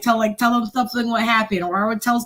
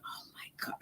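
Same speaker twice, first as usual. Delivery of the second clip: breathy whisper of panic; whispered dread; 26 dB quieter than usual. Retold or imitated. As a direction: hiss it as if caught red-handed. Caught by her officer.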